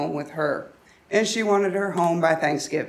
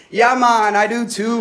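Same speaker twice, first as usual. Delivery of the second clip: loud, higher-pitched mock Jamaican accent; put-on Jamaican accent; 4 semitones higher, 6 dB louder than usual. Answering a supposed friend on the phone, mock Jamaican accent. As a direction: play along in a jokey Jamaican accent. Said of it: "speaking in a very thick Jamaican accent".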